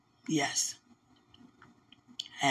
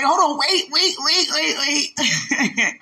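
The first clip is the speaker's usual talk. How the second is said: high, startled string of waits; startled interruption; 9 semitones higher, much slower than usual. Retold or imitated. Friend halting news that came out of nowhere, startled. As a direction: cut in, pitched high and flustered. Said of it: "'Cause it came out of nowhere".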